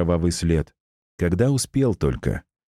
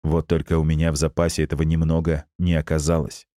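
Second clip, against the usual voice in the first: usual speaking voice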